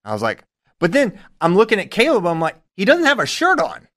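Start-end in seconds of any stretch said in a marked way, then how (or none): 2.8-3.9 high-pitched, puzzled reenactment; baffled surprise. Retold or imitated. Coach spotting that the other coach is shirtless, baffled. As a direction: play up how odd it is. Retelling own puzzled reaction.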